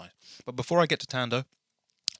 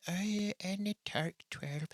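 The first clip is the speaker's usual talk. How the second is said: put-on light Irish accent, higher and softer; 10 semitones higher, 9 dB quieter than usual. Lightly Irish, recalling the end of Turk twelve. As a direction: slip into a soft Irish lilt. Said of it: "I always go into my Irish accent".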